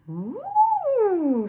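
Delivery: very high squeaky "ooh" of tiny bees; chorus of little bees. A narrator's reading. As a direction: coo like a crowd of little bees. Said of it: "said all the little bees".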